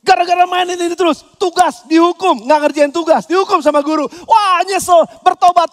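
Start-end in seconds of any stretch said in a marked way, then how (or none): none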